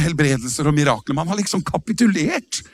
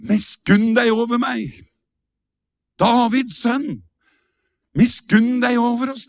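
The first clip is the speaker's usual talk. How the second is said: blind man crying out; 6 semitones higher than usual. Retold or imitated. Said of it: "roper denne blinde mannen".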